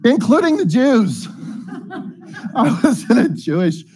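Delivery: high, laughing through the punchline; amused laughing. Real, not acted. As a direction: crack up while telling it. Laughing at own joke with audience.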